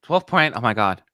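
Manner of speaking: ordinary talk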